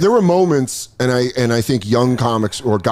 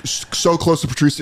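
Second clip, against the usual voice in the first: ordinary delivery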